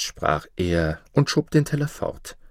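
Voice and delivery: usual speaking voice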